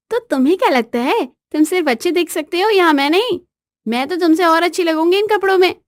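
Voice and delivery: high-pitched challenge; boastful wife. Acted. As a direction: show off and needle your husband. Wife boasts she looks better.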